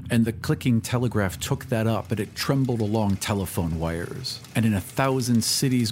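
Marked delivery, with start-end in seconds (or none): none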